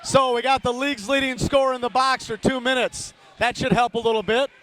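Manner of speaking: brisk live game call; radio hockey announcer calling the puck; hockey play-by-play. Acted. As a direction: call the action as it happens.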